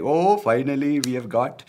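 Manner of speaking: put-on loud, high, exultant Indian accent. Comedian voicing Indians rejoicing over Kamala, put-on Indian accent. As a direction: crow it in an exaggerated Indian accent. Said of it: "I changed my accent to sound like".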